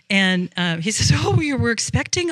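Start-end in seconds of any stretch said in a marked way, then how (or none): 1.1-2.3 a high, startled question from a husband taken aback; surprised husband. Retold or imitated. Husband hearing his wife expects guests, high surprised. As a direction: ask it as if the news just landed.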